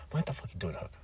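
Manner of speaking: low, hushed mock exasperation; Samoa Joe, angry. Joe catching Hook eating chips, hushed mock anger. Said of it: "He's angry".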